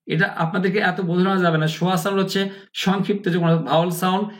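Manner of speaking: ordinary talk, nothing marked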